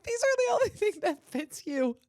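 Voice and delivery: high mock mom voice, laughing through it; hammed-up exasperated mother; a mom holding up the only clothes that fit; imitating mom. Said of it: "she'd be like".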